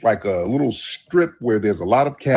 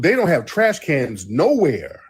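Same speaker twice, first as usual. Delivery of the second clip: usual speaking voice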